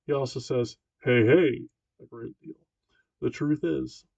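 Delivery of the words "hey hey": loud hearty hey hey; bellowing big pal. A narrator's reading. Gym teacher booming at Marty. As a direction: boom it like a jolly coach. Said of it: "he spoke in his bellowing big pal voice".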